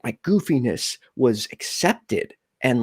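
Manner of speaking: normal talking voice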